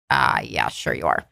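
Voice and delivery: very low, sarcastic agreement; dry, disbelieving thought; skeptical inner aside. Retold or imitated. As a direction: drop the pitch right down, humoring an obvious liar. Silent disbelief at his CIA claim.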